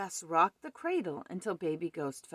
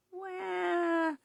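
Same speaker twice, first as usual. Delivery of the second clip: put-on very high, long drawn baby wail; 13 semitones higher than usual. A narrator's reading. Baby ghost on the step wants feeding, high wail. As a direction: cry like a newborn. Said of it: "yelled baby ghost when the milk was gone".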